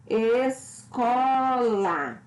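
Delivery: "Escola" very slow, with a long stressed CO; stress on middle syllable. Shown as a demonstration. Teacher showing the stronger syllable, stress on CO. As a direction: split "Escola" syllable by syllable, leaning on CO. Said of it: "pronuncio com mais intensidade".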